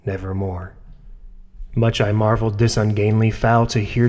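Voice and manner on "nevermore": lightly voiced raven, soft and ominous; raven's reply. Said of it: "Quoth the raven".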